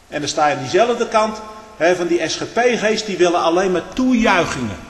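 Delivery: ordinary talk, nothing marked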